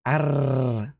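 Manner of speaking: long trilled R on Arr; rolled R. Shown as a demonstration. Streamer shows viewers their rolled R, Arr with a long trill. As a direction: roll the R in Arr in one sustained trill. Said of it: "I like rolling my R's".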